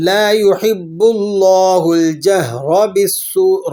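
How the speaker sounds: اللہ with a full, heavy, thick lam and the ر of الجہر heavy too; slow chanted verse with heavy L and R; heavy lam recited. Shown as a demonstration. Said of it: "اسے موٹا کر کے پڑھیں گے".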